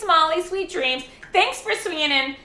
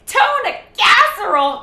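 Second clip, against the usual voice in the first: playful blurt; 9 semitones higher, 9 dB louder than usual. Pretend. Blurting silly phrase.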